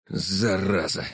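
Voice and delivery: understated vexed oath; cursing. A narrator's reading. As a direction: swear in mild frustration. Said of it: "выругался Глеб".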